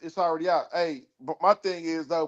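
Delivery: ordinary talk, nothing marked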